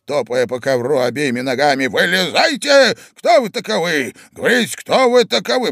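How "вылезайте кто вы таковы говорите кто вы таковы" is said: high, loud demands; old man shouting. A narrator's reading. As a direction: demand answers in a raised voice. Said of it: "кричал старик". (старик кричит, требует ответа)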